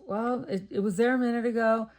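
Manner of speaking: lightly voiced puzzled friend. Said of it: "She goes".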